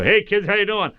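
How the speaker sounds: high, loud heroic cartoon greeting; big high superhero hello; Crimson Chin. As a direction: boom it out like the cartoon hero to kids. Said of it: "I'll go".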